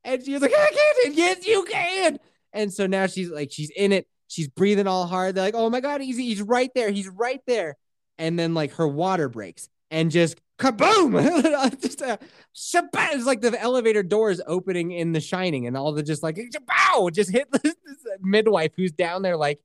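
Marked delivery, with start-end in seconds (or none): 0.5-1.1 very high, loud mimicked wail; panicked labor cry. Wife's cry against the room's cheer. 1.2-2.2 high, slow, stretched cheer, imitated; urging cheer. Room answers her cry. 5.6-7.8 retold excitement. Staff excited at the birth, retold. 10.6-11.1 loud imitated blast on "kaboom"; explosive kaboom. Imitated burst of water. 16.4-17.1 high-pitched imitated blast, "Shabow"; explosive splash noise. Imitated burst of water.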